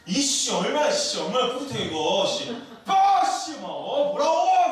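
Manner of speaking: loud, high burst of pride; cocky exultation. Acted. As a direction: crow it, swelling into a shout. Peter exults at walking on water.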